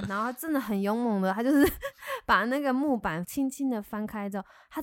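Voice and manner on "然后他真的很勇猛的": ordinary talk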